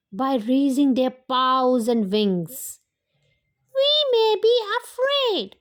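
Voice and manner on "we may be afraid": high, small timid fox voice; a shy little fox finding courage; little fox Mim. A narrator's reading. Mim the fox speaks up to the forest animals in a high small voice. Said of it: "said Mim".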